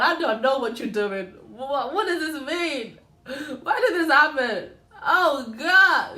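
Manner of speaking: rising desperation, laughing through it; anguished prayer. Retold or imitated. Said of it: "I tell God".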